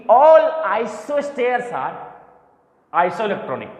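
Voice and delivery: slow, deliberate teacher stating a rule; drawn-out words for the class to catch; slow emphatic statement. Real, not acted. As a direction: spell out the rule slowly, ALL hit hard. Teacher stresses a rule for students.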